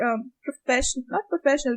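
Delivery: ordinary talk, nothing marked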